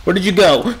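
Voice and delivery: ordinary talk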